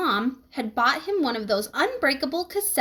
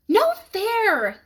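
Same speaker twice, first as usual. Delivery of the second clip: yelled; 9 semitones higher than usual. A narrator's reading. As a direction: yell it out as Janet stumbles. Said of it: "yelled Janet".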